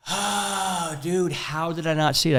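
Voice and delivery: retold rueful realization. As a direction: imitate a man kicking himself, softly. Acts out friend's dawning realization.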